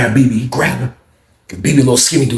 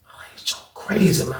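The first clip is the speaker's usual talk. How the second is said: hushed whisper to himself; 2 semitones lower, 7 dB quieter than usual. Real, not acted. Watching the fight from the back, whispering.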